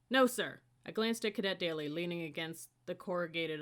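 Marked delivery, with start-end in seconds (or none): 0.1-0.6 snapped out, loud and high; quick loud reply. Acted. Cadet answering the scolding upperclassman, loud and hasty. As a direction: answer fast, caught off guard.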